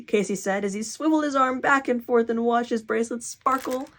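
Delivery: usual speaking voice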